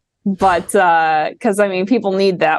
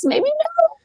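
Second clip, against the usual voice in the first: playful high squeak. Acted. Teasing maybe yes maybe no.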